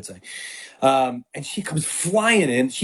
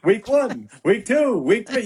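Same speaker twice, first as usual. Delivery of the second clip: Mike Francesa impression; 7 semitones higher, slower than usual. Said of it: "the old, like, Mike Francesa bit".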